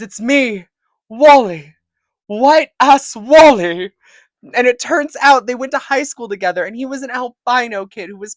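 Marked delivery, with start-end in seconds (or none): 0.0-3.9 loud reveal. Acted. Acted Wyatt's big reveal.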